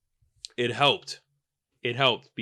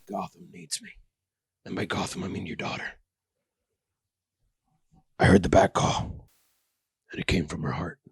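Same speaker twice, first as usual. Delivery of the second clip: low, hushed brooding hero voice ending in a whisper; mock superhero; 6 semitones lower, 4 dB quieter than usual. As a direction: brood like a self-serious vigilante. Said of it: "Just as if to say".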